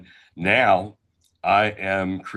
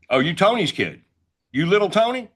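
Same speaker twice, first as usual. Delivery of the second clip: imitating restaurant customers; 10 semitones higher than usual. Customers calling him Tony's kid.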